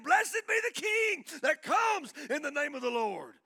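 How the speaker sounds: high, loud shout of praise; jubilant crowd shout. Acted. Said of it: "They're shouting".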